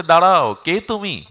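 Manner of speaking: loud, commanding challenge; sharp challenge. Acted. Hanuman stops Arjun in the garden, loud challenge. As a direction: call out to halt a stranger.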